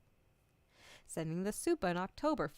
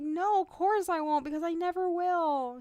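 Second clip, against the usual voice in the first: higher and louder, a flat-out refusal; emphatic refusal; 9 semitones higher, 9 dB louder than usual. Retold or imitated. Refusing the therapist's plan, a high, loud emphatic no. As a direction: shut the idea down hard.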